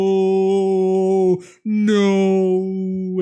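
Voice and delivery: loud, high, drawn-out no; mock anguished no. Retelling the chainsaw man's dramatic slow-mo cry, loud drawn-out no. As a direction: wail it like a slow-mo movie scene. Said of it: "slow-mo thing to make you feel emotional".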